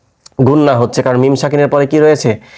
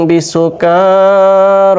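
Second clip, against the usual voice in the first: very slow chanted বিসুকার, pitched higher; Quran recitation; 4 semitones higher, much slower than usual. Shown as a demonstration. Teacher showing the reading to students, slow melodic recitation. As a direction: stretch বিসুকার in melodic tilawat. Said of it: "তাহলে কি হচ্ছে".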